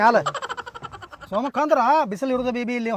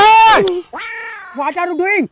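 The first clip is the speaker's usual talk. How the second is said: angry shout; 8 semitones higher, 9 dB louder, much slower than usual. Acted. Foreigner yells at driver.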